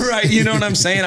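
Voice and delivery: giddy laughing boast, pitch way up; laughing excitedly. Real, not acted. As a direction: crack up while showing off. Still laughing at his brag.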